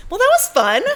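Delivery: very high, loud perky lilt; chirpy wrap-up. Real, not acted. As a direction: sum up brightly in a squeaky-high voice. Mock-cheerful wrap-up after scary story.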